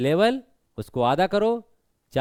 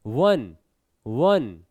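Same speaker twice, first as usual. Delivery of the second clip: वन stretched out with a falling pitch; stressed, drawn out; 5 semitones lower, much slower than usual. Shown as a demonstration. Teacher showing a stressed syllable, वन held long. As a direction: lean on वन and hold it, not instant. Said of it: "वन तुरंत नहीं आ रहा".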